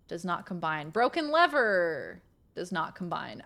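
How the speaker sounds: ordinary talk, nothing marked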